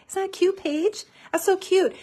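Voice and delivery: high-pitched, delighted cooing over it; real delight. Real, not acted. Crafter showing viewers her finished page, delighted. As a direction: gush over it, pitched well up.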